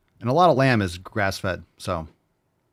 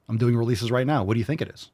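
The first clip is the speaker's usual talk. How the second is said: ordinary talk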